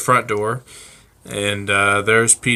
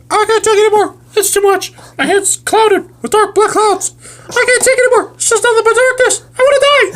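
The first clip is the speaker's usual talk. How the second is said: squeaky high bird voice, much higher and louder, fast and wailing; whiny suicidal bird; 9 dB louder, much faster than usual. Acted. Friends' improv story, bird PD wails he's done with life, high squeaky voice. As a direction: ham up a despairing pet bird.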